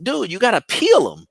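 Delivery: high-pitched mock disbelief; exasperated. Father to son cutting unpeeled potatoes, high exasperated disbelief. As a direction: correct him in a high, incredulous voice.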